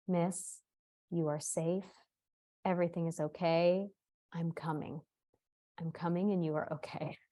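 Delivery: soft, measured reassurance with pauses; calm, lovely man. Retold or imitated. Exterminator reassuring a panicked mother on the phone, calm and soft. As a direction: soothe a frightened caller, quietly and evenly. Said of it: "in the voice of this lovely, lovely man".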